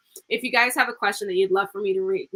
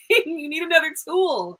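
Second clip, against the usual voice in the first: laughing through the words, a bit higher; 8 semitones higher than usual. Real, not acted. Reader laughing as she advises the client. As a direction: chuckle it out as you say it.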